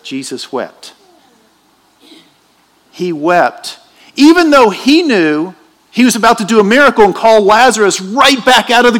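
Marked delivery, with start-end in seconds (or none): 0.0-3.8 Jesus wept stretched very slowly; very slow solemn quote; drawn out. Shown as a demonstration. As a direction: draw each word out for weight. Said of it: "The shortest verse in the Bible".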